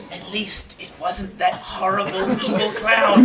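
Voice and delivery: dying words. Acted. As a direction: trail out a mock deathbed line. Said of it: "Colin's dying words".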